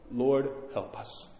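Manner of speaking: soft plea. Retold or imitated. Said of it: "cry out with their whole heart".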